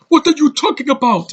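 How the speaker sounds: high, loud mock African accent; hammed-up African-accented outburst; imitated African accent. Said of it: "he has an accent. He's like".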